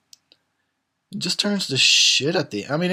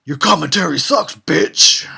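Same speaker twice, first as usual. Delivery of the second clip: Freddy taunt; 3 semitones higher, 8 dB louder than usual. Retold or imitated.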